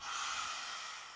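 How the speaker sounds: long breathy roar-like exhale; soft open-mouthed lion exhale; lion's breath exhale. Shown as a demonstration. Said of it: "breathe out lion's breath again".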